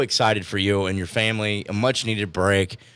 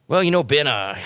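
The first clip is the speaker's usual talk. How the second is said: Joe voice attempt; 5 semitones higher than usual. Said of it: "I can't do a Joe voice".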